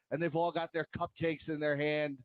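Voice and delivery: ordinary talk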